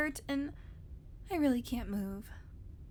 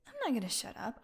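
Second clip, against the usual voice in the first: ordinary talk, nothing marked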